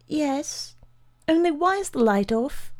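put-on high-pitched small boy's voice; high, hesitant boy; timid child. A narrator's reading. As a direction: ask it as an uneasy child in the dark. Frightened boy answers his father.

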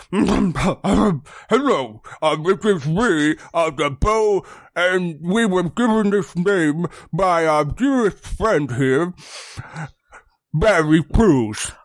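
lightly voiced creature head; turkey head voice. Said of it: "the turkey head kind of turns and is like".

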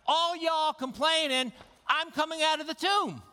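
playful high mock voice; cheeky Lazarus. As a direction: tease the complainers as a cheerful risen man. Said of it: "Lazarus is like".

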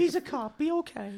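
put-on a mother soothing her child; crowd mother.

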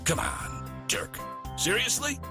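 ordinary delivery

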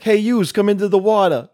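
mock Jersey gangster call; New Jersey gangster. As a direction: wave them into the water in thick Jersey. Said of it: "Jason would be like".

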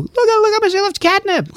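very high, cooing owner voice; squeaky gushing cat owner; mocking gushing owner. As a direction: coo like a doting pet owner. Mocking people with catnip.

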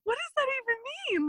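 very high, baffled retold question; incredulous. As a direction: ask it in high disbelief. Speaker's incredulous reply retold.

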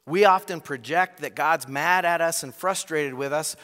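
usual speaking voice

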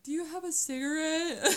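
high, half-crying half-laughing ask; teary laughing plea. Retold or imitated. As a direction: beg through mock tears and laughter. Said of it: "I was like".

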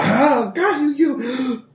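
high, frantic burning man's cry; panicked scream. Acted. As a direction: shriek it out as flames take him. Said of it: "he starts screaming".

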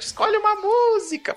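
quoting the chat in a high squeaky voice; high mock quote. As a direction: pitch it way up, teasing. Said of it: "o Chicoio cantou aqui pelo chat".

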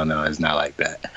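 soft whisper, shaking it off; whispered denial. Retold or imitated. Prince whispering to the fans that the lyric isn't like that. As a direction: hush it out, gently dismissive.

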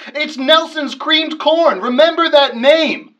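ad announcer. Acted. Ad announcer tagline.

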